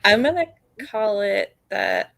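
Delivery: ordinary talk, nothing marked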